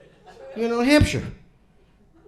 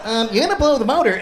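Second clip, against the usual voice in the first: higher, hurried nervous-crewmate impression; fast, pitched-up objection; Richard Dreyfuss; 4 semitones higher, 3 dB louder, faster than usual. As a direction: mimic a hesitant warning, quick and high. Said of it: "And Richard Dreyfuss like".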